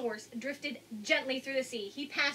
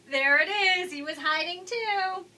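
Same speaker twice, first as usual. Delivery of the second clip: higher and louder, delighted; excited reveal; 7 semitones higher, 8 dB louder than usual. Real, not acted. Reader showing children the hidden stonefish, higher, louder and delighted. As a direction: pop out the find with delight.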